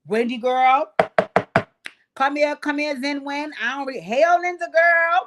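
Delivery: high, loud hollering across the room; calling out. Pretend. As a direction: holler for her to come over. Calling out to cast members.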